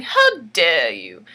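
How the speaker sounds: slow low menacing demand. Acted. Alfonso confronting Aretha, low and menacing. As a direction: drag it out like a villain king. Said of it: "Alfonso saying".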